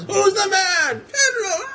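very high mock cheering; retold stadium chant; screamed call-and-response. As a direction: imitate hero and crowd hollering back and forth. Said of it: "And he's screaming out".

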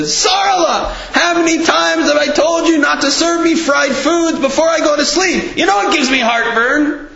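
very high whiny scolding husband voice; complaining husband. Retold or imitated. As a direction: nag his wife about the fried food. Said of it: "and he said".

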